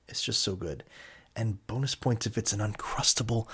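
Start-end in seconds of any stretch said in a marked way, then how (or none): 0.1-0.8 hushed repeat. Real, not acted.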